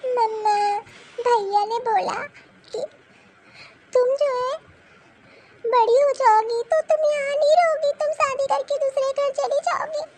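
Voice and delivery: put-on high child voice, crying while complaining; a hurt girl sobbing out everything to her mother; tearful little girl. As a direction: daughter crying to mama about brother and grandma.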